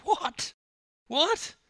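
very high, stunned little outburst; disbelief. Acted. Stunned swimmer hearing he must run home, disbelief. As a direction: squeak it out, incredulous. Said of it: "he said in disbelief".

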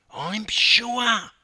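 high, loud impatience; insist, losing patience; impatient. A narrator's reading. Wife answering her doubting husband, impatient. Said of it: "A hint of impatience in Tracy's voice".

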